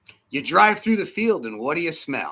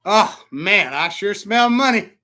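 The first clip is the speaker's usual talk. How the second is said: relishing; 4 semitones higher, 7 dB louder, slower than usual. Acted. Acts delight at farm smell.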